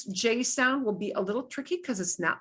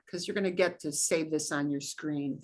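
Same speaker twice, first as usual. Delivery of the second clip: ordinary talk, nothing marked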